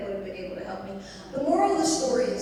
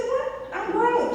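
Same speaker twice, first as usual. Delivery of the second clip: indignant protest; 12 semitones higher, much slower than usual. Retold or imitated. Daughter protesting to mother.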